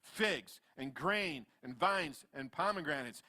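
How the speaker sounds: usual speaking voice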